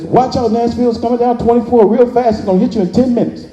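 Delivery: hammed-up news alarm; urgent reporter. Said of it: "the reporter says".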